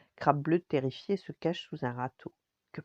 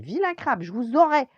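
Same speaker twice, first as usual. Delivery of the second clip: put-on high, loud boy's threat; high-pitched vengeful kid yell; child crying out; 9 semitones higher, 8 dB louder than usual. A narrator's reading. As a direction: shout it like a cross little boy. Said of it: "Mais Lucas s'écrie".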